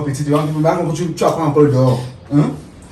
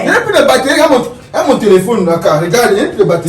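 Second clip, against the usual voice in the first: excited protest; 8 semitones higher, 4 dB louder than usual. Acted. Il se défend, excusé.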